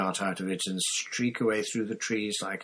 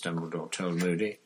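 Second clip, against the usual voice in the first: usual speaking voice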